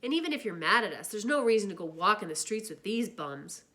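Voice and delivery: put-on jilted boyfriend angrily insulting the greasers; angry. A narrator's reading. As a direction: sneer at the rivals in anger. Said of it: "he got angry".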